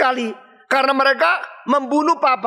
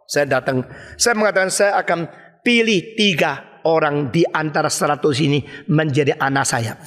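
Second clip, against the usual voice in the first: ordinary talk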